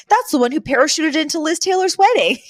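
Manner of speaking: high-pitched imitation of a thrilled fan; Fran. Pointing out a notorious paparazzo, imitated high excitement. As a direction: gush it high all the way through. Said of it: "over the moon".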